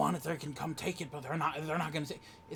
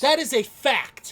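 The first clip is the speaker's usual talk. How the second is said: "That is a fact" yelled high and loud, slowed, hitting "that"; shouted; 9 semitones higher, 16 dB louder, slower than usual. Real, not acted. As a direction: slam each word down. Repeats line, second time shouted.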